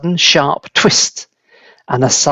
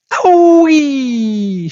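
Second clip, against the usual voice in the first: far higher, louder long cry; shrill scream; 12 semitones higher, 3 dB louder than usual. A narrator's reading. Grandma shooting up after a dose, high squeal. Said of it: "she screamed".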